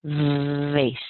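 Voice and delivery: "Vase" with a long buzzing v, rhyming with face; stretched, voiced opening on "Vase"; voiced V demo. Shown as a demonstration. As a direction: hold the vibrating v, then finish "Vase". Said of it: "add a little voice and vibration".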